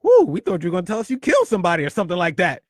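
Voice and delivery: loud, quick and relieved; relieved whoop. Retold or imitated. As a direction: blow off the tension with a whoo. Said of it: "They were like".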